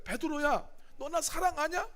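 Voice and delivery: a hint of Jesus asking. Jesus asks Peter again.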